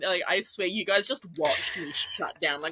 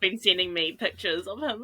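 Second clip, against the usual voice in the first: usual speaking voice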